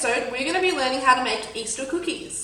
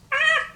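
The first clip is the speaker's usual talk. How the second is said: sudden high-pitched "ah"; short high yelp; 4 dB louder than usual. Real, not acted. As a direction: let out a quick high squeal. Startled cry before the challenge.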